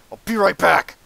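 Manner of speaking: loud, high yell of exasperation; short angry outburst, shouted; frustrated scream. Real, not acted. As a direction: scream it as you lose. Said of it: "Screamed a little bit".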